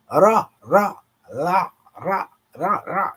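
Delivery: Ra, ra, la, ra said high and sharp as a sound drill; rolled ra and la. Shown as a demonstration. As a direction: repeat Ra, ra, la, ra like a teacher's drill. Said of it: "You, you can't really separate 'em".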